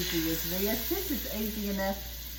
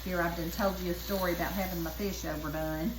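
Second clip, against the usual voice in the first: normal talking voice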